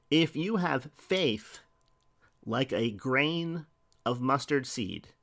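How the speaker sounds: slow, measured, each word weighed; slow scripture reading. Acted. Preacher reading Jesus's words to the congregation, slow and measured. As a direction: read the verse slowly like a sacred promise. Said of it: "slow scripture reading".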